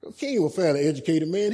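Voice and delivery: ordinary talk, nothing marked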